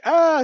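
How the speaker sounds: a sudden high, loud yelp of panic; mock fearful gasp. Retelling his wife's panic before going on stage, high yelp. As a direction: yelp like she's frozen in fright. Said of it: "she's in a fear storm".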